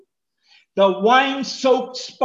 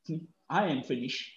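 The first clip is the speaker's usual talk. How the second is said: weak defeated sigh; 5 semitones lower, 10 dB quieter than usual. Shown as a demonstration. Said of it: "He didn't say".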